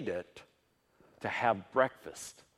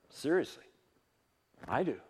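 normal talking voice

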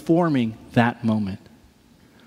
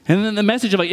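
normal talking voice

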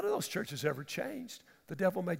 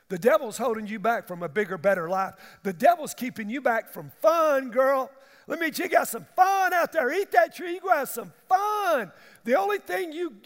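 higher, louder coaxing devil; lightly voiced tempter; 5 semitones higher, 7 dB louder than usual. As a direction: coax her like a salesman.

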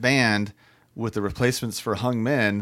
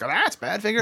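recognizing fan; 10 semitones higher than usual. Retold or imitated. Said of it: "you'd be like".

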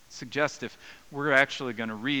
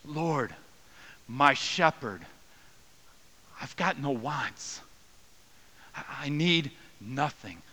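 crying out in anguish; 4 semitones higher, slower than usual. Shown as a demonstration. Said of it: "crying out".

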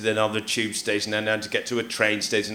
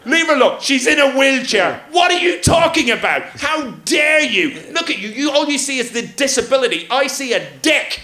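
much higher, louder mock yelling; hammed-up outraged bystander yell; mock outraged shout; 13 semitones higher, 5 dB louder than usual. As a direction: play the furious onlooker, shouting.

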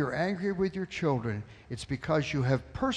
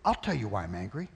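lower, quieter, quick grumble; grumbling retort; 8 semitones lower, 5 dB quieter, faster than usual. Retold or imitated. Angry father answering the visitor, low and grumbling. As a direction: growl it back defensively. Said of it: "What do you think I would say?".

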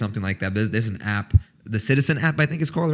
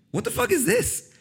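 much higher, startled retold exclamation; shocked. Retelling finding a pimple on her finger, much higher startled cry. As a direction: recall the shock of the pimple, pitch way up. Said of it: "So I was like".

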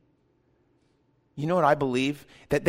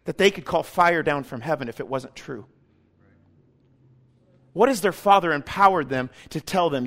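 ordinary talk

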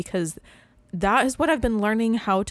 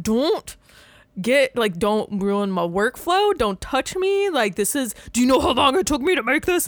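higher, louder exasperated pleading; mock irritated artist; 5 semitones higher, 4 dB louder than usual. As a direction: protect your workflow in mock outrage. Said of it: "I'm just like".

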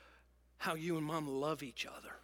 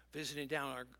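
ordinary talk, nothing marked

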